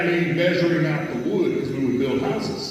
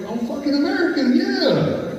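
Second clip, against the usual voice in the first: much higher indignant retort; offended, sharp comeback; answering the foreman, offended and high; 13 semitones higher than usual. Retold or imitated. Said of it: "I was like".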